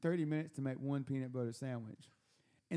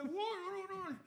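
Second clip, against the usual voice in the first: high-pitched mock holler; yelling at doorknob. Paranoid host talking to the doorknob, high mock yell. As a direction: holler it high into a door handle. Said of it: "yelling at the door handle".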